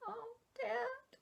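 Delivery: put-on high, quiet, crying child voice; a little boy near tears; tearful child. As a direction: son crying as dad leaves, soft tearful whimper. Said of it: "lips started quivering".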